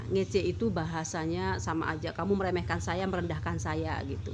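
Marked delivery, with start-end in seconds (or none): none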